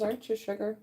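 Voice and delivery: ordinary talk, nothing marked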